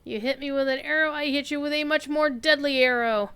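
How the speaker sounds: loud, gloating taunt. Real, not acted. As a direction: gloat over the kill. Taunting the enemy.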